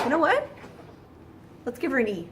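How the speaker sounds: high, rising, playful announcement; mock bright idea. French people inventing a rule on a whim, mock bright idea. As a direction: pitch it up like a sudden whim.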